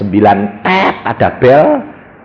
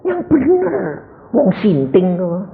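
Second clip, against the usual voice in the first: high-pitched incredulous wife; disbelieving wife; 8 semitones higher, 4 dB quieter than usual. Acted. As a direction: scoff in a high voice, slowing at the end. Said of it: "Istri saya bilang gini".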